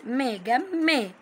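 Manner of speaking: "جم، ما" as two beats, gam-ma; letter pronounced twice. Shown as a demonstration. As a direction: close on the m of "جم", restart it in "ما". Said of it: "نطقناها مرتين".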